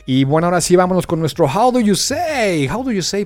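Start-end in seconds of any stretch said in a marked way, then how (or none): 1.5-3.2 presenta la sección con energía de locutor; anúncialo como título de programa; anuncio animado. Acted. (presentando su sección a los alumnos, tono de locutor animado)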